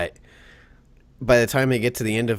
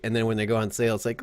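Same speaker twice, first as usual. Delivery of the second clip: normal talking voice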